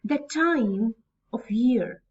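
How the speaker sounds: TIME stretched out after a light "the"; slow iambic reading, beat on TIME; stress on TIME. Shown as a demonstration. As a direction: go slowly and lean on "time". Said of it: "I will read like this".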